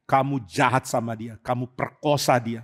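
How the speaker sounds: level the blame in a low threat; quiet low-voiced brother accusing; cold accusation. Absalom confronts his brother.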